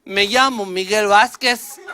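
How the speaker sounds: student answering with native Spanish pronunciation. Retold or imitated. Student correcting the teacher with native Spanish pronunciation. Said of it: "argued with a Mexican foreign exchange student".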